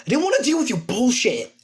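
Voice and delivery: high-pitched annoyed protest; acted irritated comeback; exasperated retort. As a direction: protest hotly with the pitch jumping up.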